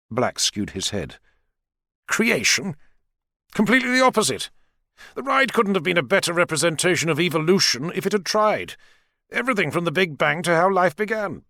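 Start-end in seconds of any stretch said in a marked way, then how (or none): 2.1-11.4 much higher, crisp scientist voice; lightly voiced confident scientist.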